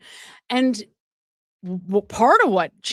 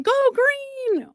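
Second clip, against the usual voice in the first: high, drawn-out imitation of a cheer; mock scream. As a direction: imitate her screaming from the sidelines, pitched way up. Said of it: "She's screaming".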